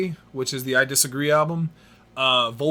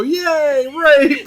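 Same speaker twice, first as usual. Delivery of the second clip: mock crowd cheer; 12 semitones higher, 7 dB louder than usual. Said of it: "someone in the crowd go".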